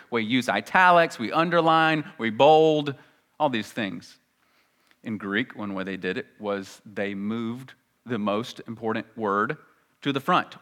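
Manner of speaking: ordinary talk, nothing marked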